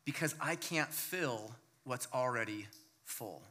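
tender voice of God. Acted. Preacher voicing God to the congregation, soft and tender. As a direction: speak as God, quietly reassuring. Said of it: "I think that God is saying".

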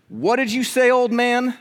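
high-pitched mock challenge; retold yell. Pastor confronting his old neighbor, retold yelling. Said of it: "I just yelled at my neighbor".

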